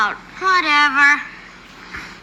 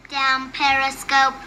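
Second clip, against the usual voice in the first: slow, even-pitched robot echo; robot girl; 5 semitones lower, 4 dB quieter, slower than usual. Acted. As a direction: repeat the command like a machine. Robot girl echoes a command.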